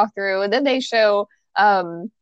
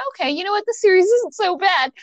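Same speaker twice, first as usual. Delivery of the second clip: relieved; 10 semitones higher than usual. Retold or imitated. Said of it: "I'm like".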